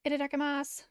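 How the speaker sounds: hearty anime-style itadakimasu; cheerful call. Acted. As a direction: sing it out before digging in.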